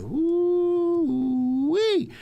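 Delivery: high, loud woo-wee squeal; thrilled squeal over Bitcoin; excited whoop. Real, not acted. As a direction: let out a giddy high whoop. Excited exclamation about Bitcoin.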